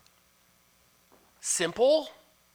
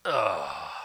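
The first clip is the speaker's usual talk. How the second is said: low reluctant groan; a deep grudging ugh; 11 semitones lower than usual. Real, not acted. Pastor groans at the verse's demand, low. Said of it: "I wish it wouldn't have said that".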